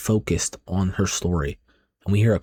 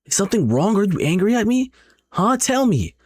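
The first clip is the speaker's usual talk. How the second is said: high-pitched, upset demanding questions; acted yelling; 11 semitones higher than usual. Friend gets no response from the girl, acted yelling. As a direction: press for an answer, pitch climbing. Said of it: "She starts yelling at her instantly".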